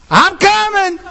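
a distracted sinner answering Jesus's call; breezy sinner reply. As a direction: promise to come, stay put. Distracted sinner still promising.

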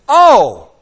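loud, high eager exclamation; like a kid who just got an idea; Jesus, bright and eager; Jesus, delighted. Retold or imitated. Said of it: "And Jesus says".

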